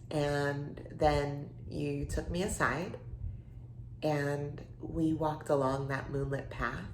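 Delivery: soft, slow recollection; slow right down and speak softly, lost in the memory; tender reminiscence. Acted. Reminding old friend of the night they kissed, tender.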